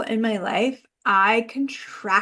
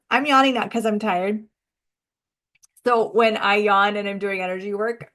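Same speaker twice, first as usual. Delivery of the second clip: ordinary delivery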